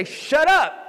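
forceful shout of shut up; loud rebuke. Shown as a demonstration. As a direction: yell it down with a backbone. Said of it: "get a backbone with the enemy and say".

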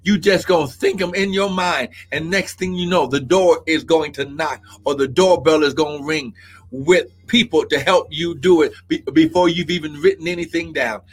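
hushed prophetic ministry. Real, not acted. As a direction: speak a gentle word of prophecy to Sister Crystal. Prophetic word over a congregant.

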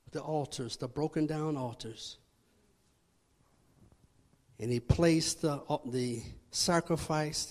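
low, soft, solemn narration; suspenseful drop to a low preaching voice; hushed sermon storytelling. Acted. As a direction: tell it quietly, piece by piece, with pauses. Hushed, solemn telling of altar scene.

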